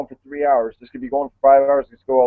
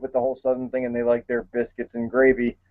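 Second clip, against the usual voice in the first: ordinary delivery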